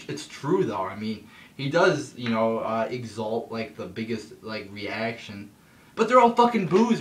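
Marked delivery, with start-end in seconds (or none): 5.9-7.0 much higher and louder, fed up; exasperated outburst. Real, not acted. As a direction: blurt it out in disbelief. Exasperated at the crowd reaction.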